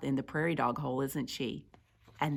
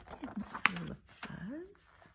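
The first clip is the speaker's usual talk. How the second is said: hushed whisper; whispered denial; 6 semitones higher, 11 dB quieter than usual. Acted. Accused prairie dog denying the theft, whispered. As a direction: whisper it, barely audible.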